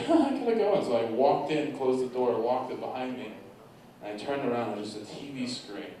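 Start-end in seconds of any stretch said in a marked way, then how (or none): 0.1-0.7 high-pitched, hurried mock alarm; high, giddy retold reaction; mock flustered. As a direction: act out a flustered urge to bolt. Acts out nervous exit in story.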